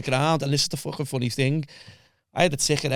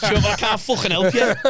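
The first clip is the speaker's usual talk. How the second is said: higher, louder mock of a snappy solicitor; snapping solicitor; 8 semitones higher, 6 dB louder than usual. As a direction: bite back at him like an irritated lawyer. Solicitor snaps back at him.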